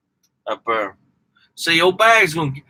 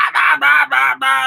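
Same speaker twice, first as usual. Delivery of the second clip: high, loud nonsense jabber; loud Boston yelling; 9 semitones higher, 4 dB louder than usual. Retold or imitated. Southerner mimicking Bostonians who talk with their hands, loud mock yelling. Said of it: "they talk real loud".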